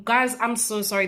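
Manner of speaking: ordinary talk, nothing marked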